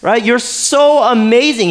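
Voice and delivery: high-pitched, gushing flattery; butter up the king with fake delight; over-the-top sucking up; fawning servant. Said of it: "when you're serving the king, like".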